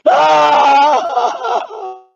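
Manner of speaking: long high scream breaking into crying wail; scream, then let it quaver out. Pretend. Frightened searcher out hunting spirits, long crying scream.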